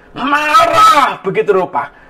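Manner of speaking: high, drawn-out "Marah"; dramatic emphasis. Retold or imitated. Preacher shows passenger's huge anger, drawn-out "Marah".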